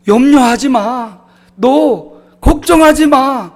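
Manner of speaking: God reassuring the weary pastor. Acted. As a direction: console him with growing fervor. God's comforting voice quoted.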